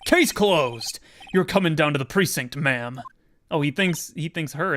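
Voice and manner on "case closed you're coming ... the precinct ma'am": loud, gravelly tough-guy cop; gravelly detective voice. Acted. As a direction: growl it like a gruff old detective. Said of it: "Doing those gravelly voices is killing me".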